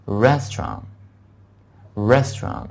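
"restaurant" said slowly, stress on the first syllable; slow, teacherly "restaurant" for repeating; pronunciation demo. Shown as a demonstration. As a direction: model "restaurant" carefully, pausing between repeats. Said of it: "再跟我重温一下这个".

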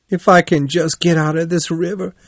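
high-pitched, pleading vow; desperate cry. A narrator's reading. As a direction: cry out a promise while struggling in water. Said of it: "he cried".

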